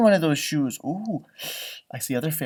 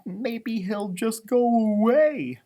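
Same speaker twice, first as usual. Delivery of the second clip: high, slow and sunny puppy; cheerful puppy voice; 8 semitones higher, much slower than usual. Acted. Puppy Lucky chirping to Mackenzie about rain. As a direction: bubble over like a happy little dog. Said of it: "Lucky said".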